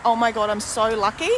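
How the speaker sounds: ordinary talk, nothing marked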